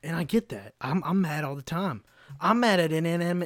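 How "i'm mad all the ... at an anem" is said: normal talking voice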